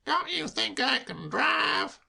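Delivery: affronted high voice; protest, wounded; high, slow, indignant. Acted. Soapy indignant.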